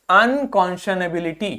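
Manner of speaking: ordinary talk, nothing marked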